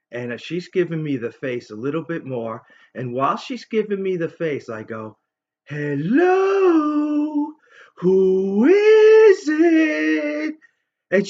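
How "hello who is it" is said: very high, loud, stretched-out falsetto hello; mock the kid with a squeaky phone voice; drawn-out squeal of a phone greeting; silly falsetto. Pretending to answer phone.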